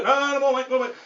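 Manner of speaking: high, loud urging; insistent directing. Acted. Ferry marshal urging a driver closer, insistent. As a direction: keep the car creeping forward.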